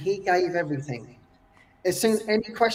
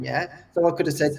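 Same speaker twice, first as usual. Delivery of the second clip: ordinary talk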